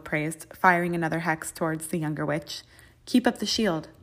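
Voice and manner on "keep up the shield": commanding call. A narrator's reading. McGonagall commanding in duel.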